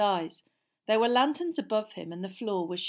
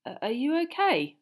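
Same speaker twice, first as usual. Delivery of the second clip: nervous; 7 semitones higher, 5 dB louder than usual. A narrator's reading. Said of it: "Ron asked nervously".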